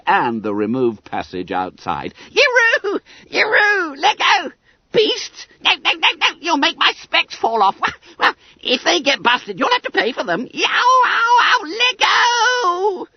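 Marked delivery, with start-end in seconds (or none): 2.3-13.1 very high, loud comic wailing; frantic yell. A narrator's reading. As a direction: yell as if hauled upside down. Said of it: "A frantic yell".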